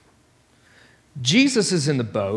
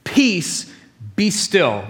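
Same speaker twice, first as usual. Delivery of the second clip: commanding; 5 semitones higher, much slower than usual. Retold or imitated. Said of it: "he looks over the sea".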